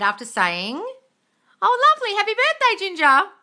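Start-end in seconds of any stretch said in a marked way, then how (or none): 1.6-3.3 high, loud cheery exclamation; bright burst of congratulations; gushing birthday greeting. Real, not acted. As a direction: sing out your delight for them. Breaks off to congratulate a viewer.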